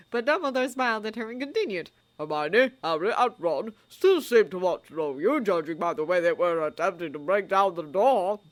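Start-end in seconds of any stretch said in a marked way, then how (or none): 2.2-8.4 put-on louder, gently teasing old man; old wizard voice. A narrator's reading. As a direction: tease kindly. Dumbledore's voice.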